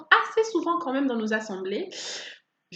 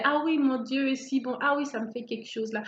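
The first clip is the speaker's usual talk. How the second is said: ordinary delivery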